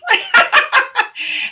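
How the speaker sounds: high burst of laughter. Real, not acted. Sister laughing after teasing the host, amused laugh.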